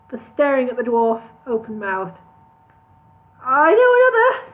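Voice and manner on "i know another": high, eager and hopeful. Acted. Dwarf offering another song to Al, high hopeful voice. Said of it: "said Maury hopefully".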